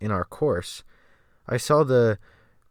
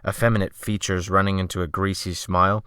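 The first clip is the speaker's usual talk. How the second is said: ordinary delivery, nothing marked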